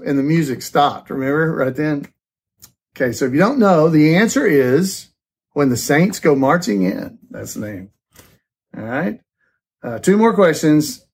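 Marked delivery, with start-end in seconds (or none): none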